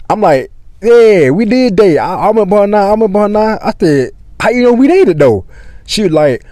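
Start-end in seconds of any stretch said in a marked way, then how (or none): 0.8-3.6 stretched, pitched-up "oh yeah" recall; acted admission. Retold admission once memory returns. 4.4-5.5 high-pitched, questioning disbelief; retold incredulous query; acted puzzlement. Retold puzzled question to his girl.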